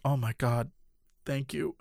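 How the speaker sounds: low, very soft retold relief; hushed relief. Passenger as troublesome girl falls asleep, hushed relief. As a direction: sigh it privately, as if not to wake her.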